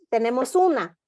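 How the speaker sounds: ordinary talk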